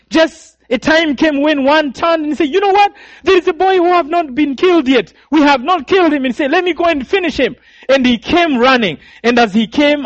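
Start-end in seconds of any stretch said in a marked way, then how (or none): 2.5-6.2 much higher, louder shout of alarm; alarmed killer. Retold or imitated. Killer telling his comrades the boy still lives, high and loud. 6.5-7.6 high, fast and eager; eager killer. Retold or imitated. Killer offering to finish the boy, high and fast.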